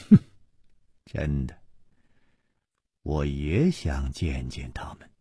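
low, quiet, sceptical sneer; cold sneer. A narrator's reading. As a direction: open with a short snort, then soft and low. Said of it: "楚留香冷笑着说". (冷笑着低声说)

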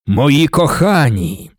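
drawn-out proclamation to the crowd; resounding call. A narrator's reading. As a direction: address a vast hall of devoted followers, very slowly. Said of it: "Його голос залунав над зверненими".

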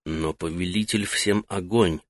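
soft hushed reply under the breath; barely voiced, almost mouthing it; whispering. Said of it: "Так же неслышно ответил Таита".

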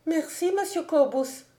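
put-on high, confused young girl's voice; lightly acted flustered girl; embarrassed girl. A narrator's reading. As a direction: thank him in a high, abashed voice. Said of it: "parut toute confuse".

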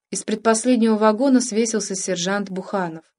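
ordinary delivery, nothing marked

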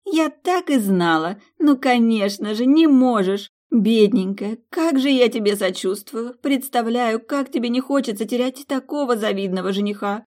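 teasing the locked-in bride; mocking. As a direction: fake pity, laying it on thick. Suzie mocks her friend.

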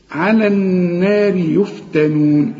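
على النار يفتنون chanted slowly, light letters; slow recitation. Shown as a demonstration. Teacher recites the verse for students. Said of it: "كل الحروف مرققة".

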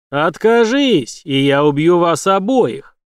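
loud, very slow threat; slow menacing threat. A narrator's reading. Villain threatening to kill them, loud slow menace. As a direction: drag out every word as a death threat.

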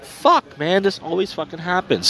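high-pitched fed-up curse trailing off; exasperated groan. Retold or imitated. Short two people again, he vents a high, fed-up curse. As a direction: squeal it like it always happens to you. Lineup falling apart again.

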